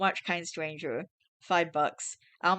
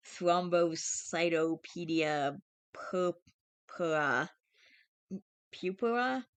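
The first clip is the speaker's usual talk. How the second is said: 1 slow stumbling; much slower than usual. Real, not acted. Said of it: "struggling with medical term".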